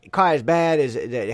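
put-on Texas accent. Shown as a demonstration. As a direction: briefly slip into a mock Texan accent. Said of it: "if we did Texas".

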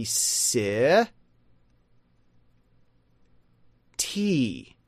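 "si, T" as sih, then tee; two stretched, separated syllables; American phonetic pronunciation. Shown as a demonstration. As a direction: sound out slowly, short I then long E. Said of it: "the American pronunciation".

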